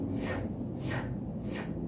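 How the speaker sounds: a long, unvoiced hiss; hissing s sound. Shown as a demonstration. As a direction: hiss a plain s without voice. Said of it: "fazer o som do S". (s sibilado sem voz)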